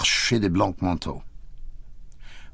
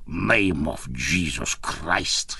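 low, drawn-out pained groan; slow, accented character moan; groaning Boris; 6 semitones lower, 3 dB quieter, much slower than usual. A narrator's reading. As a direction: groan it out very slowly, just waking in pain. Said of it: "groaned deeply".

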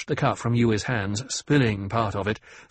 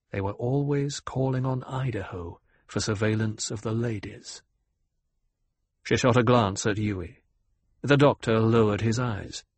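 ordinary delivery, nothing marked

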